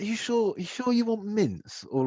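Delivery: put-on high-pitched querying of a child's choice; incredulous dad. Dad questioning his kids' choice of sweets, incredulous.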